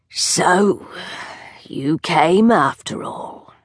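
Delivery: put-on slow, hoarse old witch voice, rusty from disuse; hoarse crone. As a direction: creak the words out as if she seldom speaks. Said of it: "her voice hoarse".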